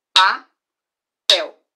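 "papel" as "pa-pel", loud and split; syllable-clapped. Shown as a demonstration. Teacher repeating the word for counting, loud. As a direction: clap each part of "papel". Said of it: "vamos de novo".

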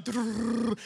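imitated rapid heartbeat trill; racing heart noise. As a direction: roll out a fluttering heartbeat sound. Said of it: "my heart's just going".

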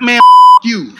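blurted curse. Retold or imitated. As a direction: blurt it out before you can stop. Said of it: "I accidentally was like".

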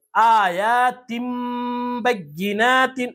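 slow آیاتم بیناتن, long m before b; tanween read as meem. Shown as a demonstration. Teacher showing the changed tanween, held m in آیاتم. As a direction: stretch and hum the end of آیاتم. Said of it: "میم سے بدل کر پڑھیں گے".

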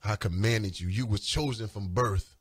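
low, solemn voice of God; low, sober divine command; God's commission. Retold or imitated. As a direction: hand down the calling, deep and measured. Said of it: "That's what he said".

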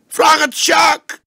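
desperate man howling the name, crying; crying shout. A narrator's reading. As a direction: wail it like a child. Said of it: "Hij huilde als een kind".

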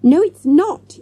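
put-on high, loud child's denial; yelling. A narrator's reading. Child correcting a classmate, yelling. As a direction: yell it back, pitched high. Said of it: "yelled Morgan".

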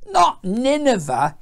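high, drawn-out grumble; groaning complaint. A narrator's reading. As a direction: moan it slowly in protest. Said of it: "he groaned".

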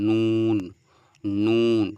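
নুন said loud, tongue tip against the palate; Arabic letter nun. Shown as a demonstration. Teacher modelling নুন for students, loud and clear. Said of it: "নুন উচ্চারণ করতে হবে".

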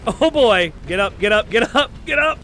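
very loud, high-pitched shouts; excited yelling. Real, not acted. Gamer urging his character up, excited yelling. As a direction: yell urgently at the top of the range.

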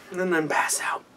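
whispered, sleepy aside. Real, not acted. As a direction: breathe it out like you're fading. Tired trailing aside.

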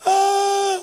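high, loud mock scream; mock shriek at a ghost; scared scream. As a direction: let out a drawn-out frightened "ah". Mimics fright at supposed ghost.

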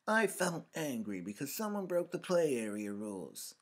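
put-on loud, high-pitched indignant little girl; high, heated outburst from an indignant child; child's fury. A narrator's reading. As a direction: complain angrily like a small child. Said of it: "to indicate her fury".

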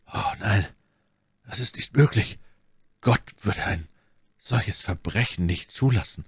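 halting, stunned disbelief; horrified. A narrator's reading. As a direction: break it up with shocked pauses. Athos horrified, suspecting poison. (entsetzt und stockend)

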